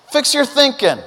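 urging call. Retold or imitated. Repeats quote with force.

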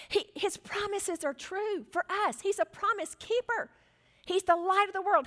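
high exhortation building from restrained to loud; fervent preaching. Real, not acted. Preacher assures people of calm in chaos, fervent.

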